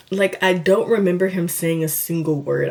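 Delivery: ordinary talk, nothing marked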